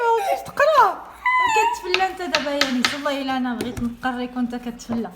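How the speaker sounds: high, loud, fed-up telling-off of her son; mother scolding her distracted son, high and loud; exasperated scolding. Real, not acted. Mother scolds son skipping reading.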